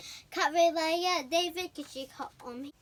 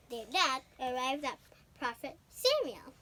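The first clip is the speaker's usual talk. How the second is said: normal talking voice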